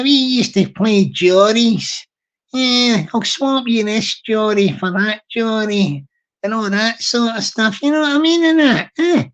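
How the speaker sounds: broad Glasgow patter, wee guy; wee Glaswegian guy voice. Acted. Explaining marbles as a Glasgow wee guy, broad Glaswegian voice. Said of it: "that was a sort of wee guy voice there".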